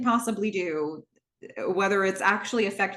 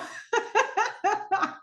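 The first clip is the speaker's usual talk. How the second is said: high airy laughter; delighted giggle; real laugh; 13 semitones higher than usual. Real, not acted. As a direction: crack up at the joke. Laughing at doctor's punchline.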